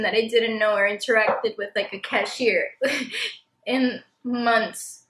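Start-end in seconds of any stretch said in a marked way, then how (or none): none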